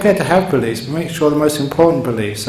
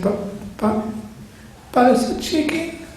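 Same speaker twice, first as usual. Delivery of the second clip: high, soft, halting whimper; hammed-up tearful squeak; mock crying; 11 semitones higher, 6 dB quieter than usual. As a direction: whimper it in broken pieces, pitched up. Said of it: "she started to cry".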